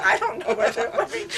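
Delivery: amused mock of a flustered man; laughing imitation. Kastori answering Swami helplessly, laughing imitation.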